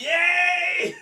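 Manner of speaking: high, loud whooped cheer; mock cheer. Drinker celebrating another forfeit, high mock cheer.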